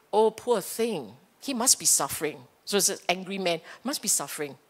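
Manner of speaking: quiet, understanding murmur to oneself; sympathetic inner voice. Acted. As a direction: say it gently, letting judgment soften into pity. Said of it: "So you're talking differently".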